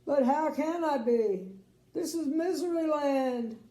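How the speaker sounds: high, loud lament; crying king. Acted. Crying king to Little Miss Sunshine, high loud lament. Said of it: "cried the king".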